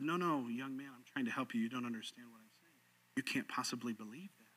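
very soft and low; hushed, low-pitched acted reply; patient, calm reassurance. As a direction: gently talk the angry heckler down. Apologist patiently calms offended young man.

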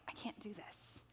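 barely audible, voiceless admission of defeat; defeated whisper. Retold or imitated. Runner overwhelmed by doubt to herself, defeated whisper. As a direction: whisper it as if giving up. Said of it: "overwhelming sense of".

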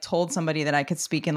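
ordinary delivery

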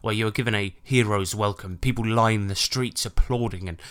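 low, calm scene setting; game master narration. Acted. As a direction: set the scene warmly.

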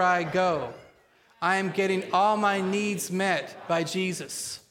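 slow, emphatic declaration with spunky attitude; strong, measured confession for a congregation to repeat; attitude of faith. Shown as a demonstration. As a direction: declare it boldly, like you believe every word. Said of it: "say it today with an attitude of faith".

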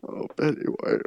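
whispered, hammed-up lament; mock grief whisper. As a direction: breathe the name like a sob. Mock grieving, repeated lament.

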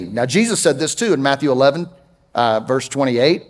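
ordinary delivery

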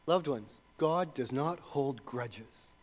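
ordinary talk

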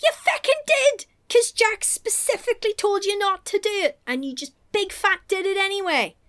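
high-pitched exasperated telling-off; acted outrage, pitched well up; mock scolding. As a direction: scold her in comic indignation. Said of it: "And you're like".

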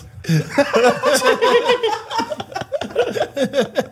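real drawn-out laughter, pitched high; helpless laughter at the dog story; long high laugh. Real, not acted.

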